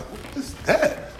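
breathy, slow, frightened whisper; hushed spooked question; scared whisper. Retold or imitated. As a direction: hiss it out in fear. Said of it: "I was like".

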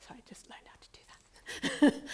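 sheepish whispered aside; apologetic hushed whisper. Real, not acted. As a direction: drop to a quick whisper. Whispered aside about slides.

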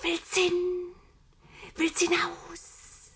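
high, slow, sibilant snake voice; slow serpent lisp in a high whisper; hissing voiceless whisper. A narrator's reading. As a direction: whisper temptingly, drawing out every hiss. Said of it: "Es zischte und wisperte ganz ohne Stimme".

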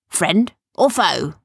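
slow, loud pretend challenge; fierce pirate act. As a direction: challenge them like a play pirate. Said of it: "switching back into his fierce pirate mode".

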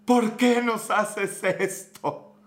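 slow, drawn-out pleading cry; anguished plea. Retold or imitated. Fan pleading with his losing team, slow anguished lament. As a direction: beg the team like a heartbroken fan. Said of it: "terminaba gritando cosas como".

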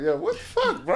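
high, laughing disbelief; disbelieving laugh. Retold or imitated. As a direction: laugh it out in shock. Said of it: "It's like".

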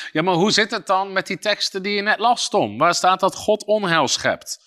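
ordinary talk, nothing marked

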